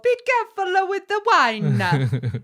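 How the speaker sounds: high, loud, drawn-out mock Italian accent; weird Italian accent. Co-host warns an imagined Vincenzo about wine, mock Italian accent. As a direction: call out in a hammed-up Italian accent. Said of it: "that's a weird Italian accent".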